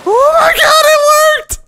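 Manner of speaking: high, loud joyful shout; excited shout. Real, not acted. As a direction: yell it in disbelief. Plan succeeds, excited shout.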